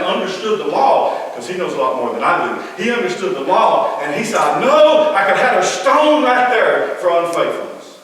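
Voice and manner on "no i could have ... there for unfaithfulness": 'No' shot out much higher and louder; loud, high-pitched insistence; forceful outburst. Acted. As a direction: hit it like you can't believe it. Joseph's right under the law.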